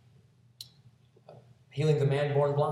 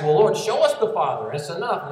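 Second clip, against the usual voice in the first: louder, eager plea to see the Father; Philip's eager demand, louder; quick eager ask; 4 semitones higher, 8 dB louder than usual. Acted. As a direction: blurt it out, eager and hurried. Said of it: "quickly ask".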